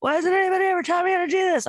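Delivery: imitating her son's panicked rant; whiny teen outburst.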